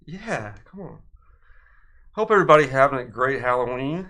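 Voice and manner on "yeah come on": ghost voice. Acted. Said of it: "that's your ghost voice".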